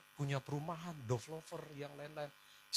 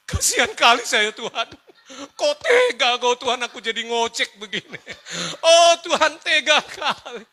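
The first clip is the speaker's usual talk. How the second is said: high, loud wail to God; mock crying; 3 semitones higher, 8 dB louder than usual. Rider asking God how He could, mock wailing. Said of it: "ini masih nangis".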